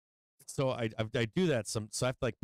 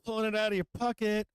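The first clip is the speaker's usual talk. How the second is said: gloating taunt; 8 semitones higher, faster than usual. Real, not acted. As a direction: taunt the losing traders with glee. Continued gloating at traders.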